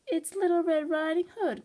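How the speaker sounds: high-pitched little girl imitation. A narrator's reading. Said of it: "in a little girl voice".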